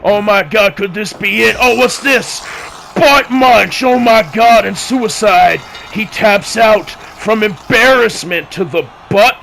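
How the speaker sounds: screaming high, frantic finish call; wrestling commentator. Acted. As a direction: lose it as the finisher lands.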